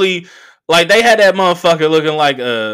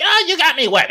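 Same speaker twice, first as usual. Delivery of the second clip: mock whiny complaint; 13 semitones higher than usual.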